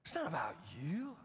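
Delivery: ordinary talk, nothing marked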